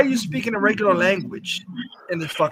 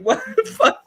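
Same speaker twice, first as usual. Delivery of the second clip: laughing disbelief. Retold or imitated. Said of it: "I'm like".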